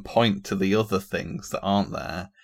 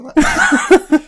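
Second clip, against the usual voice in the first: high, loud laughing line; laughing through the words; 15 dB louder than usual. Real, not acted. Laughing at own joke.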